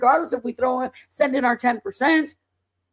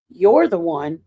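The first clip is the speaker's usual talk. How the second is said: lightly voiced Lord, low and firm; lower, louder, pointed reproof; imitating God speaking frankly to her; the Lord's frank voice; 6 semitones lower, 5 dB louder than usual. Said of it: "this is how he talks to me".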